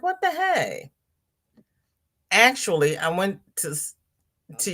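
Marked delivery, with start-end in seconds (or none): 0.0-0.9 high, startled what the hey; surprised. Retold or imitated. Seeing the parking pass scheduled, a high surprised squeak. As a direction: squeak it out in disbelief. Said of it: "I'm like".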